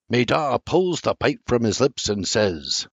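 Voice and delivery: ordinary delivery, nothing marked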